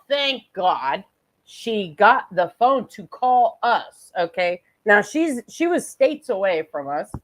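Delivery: ordinary delivery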